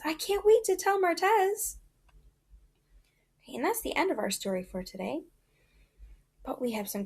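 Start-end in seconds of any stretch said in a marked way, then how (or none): 0.0-1.8 high, louder eager cry; excited shout. Acted. Kay jumping up to tell about Martez, excited shout. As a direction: jump up and shout it. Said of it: "Kay jumps up and shouts".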